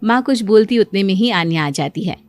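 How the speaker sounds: ordinary delivery, nothing marked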